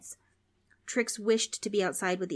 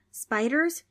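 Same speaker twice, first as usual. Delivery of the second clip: put-on scared kid; 8 semitones higher, 5 dB louder than usual. Scared kids ask.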